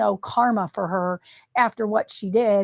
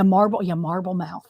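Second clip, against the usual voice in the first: normal talking voice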